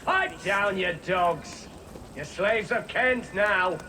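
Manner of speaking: gruff shouted command. Acted. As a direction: shout them down, starting high, slowing at the end. Guard shouting down captives.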